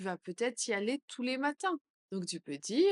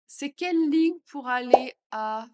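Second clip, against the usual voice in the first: louder question; louder take; 3 semitones higher, 8 dB louder than usual. Shown as a demonstration. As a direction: ask it out loud. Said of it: "phrase modelled twice". (plus fort)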